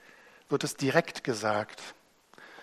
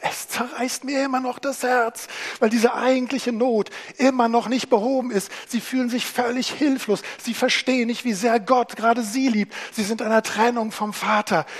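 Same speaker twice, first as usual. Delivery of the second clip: higher, louder anguished plea; Jesus, heartbroken; 9 semitones higher, 7 dB louder than usual. Acted. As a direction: speak as Jesus pained for the crowd. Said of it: "er sagt".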